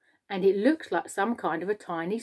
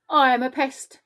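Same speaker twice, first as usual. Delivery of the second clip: higher, louder boast; proud announcement; 6 semitones higher, 5 dB louder than usual. A narrator's reading. Centipede seeking the room's approval, proudly announcing. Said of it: "the centipede announced".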